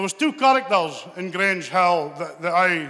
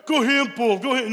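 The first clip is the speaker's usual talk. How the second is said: big brother; 5 semitones higher than usual. Retold or imitated. Big brother voiced in story.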